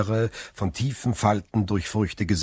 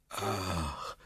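much softer, distant let-down "Ach"; disappointed sigh; 11 dB quieter than usual. A narrator's reading. Old prisoner softly sighs in disappointment from the tunnel. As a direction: let the hope drain out. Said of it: "den enttäuschten Ausruf".

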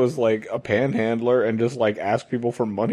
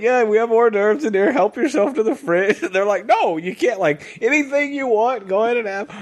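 much higher, overly eager host voice; 9 semitones higher than usual. Pretend. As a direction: push snacks on bewildered guests, pitched way up. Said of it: "you're like".

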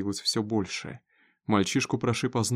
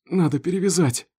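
raised, high-pitched urgency; urgent cry; 7 semitones higher, 5 dB louder than usual. A narrator's reading. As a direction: press for help through pain. Urgent exchange over a wound. (срочно, повышенным голосом)

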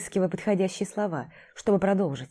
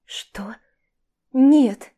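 alarmed refusal; 6 semitones higher, 6 dB louder than usual. Acted. Vika refusing, shocked.

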